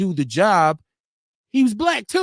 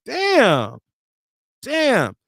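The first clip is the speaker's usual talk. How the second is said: ordinary talk, nothing marked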